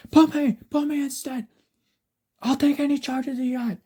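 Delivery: high-pitched cry to be taken instead; desperate plea. A narrator's reading. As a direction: plead loudly, voice pushed way up. Inmates begging to swap places.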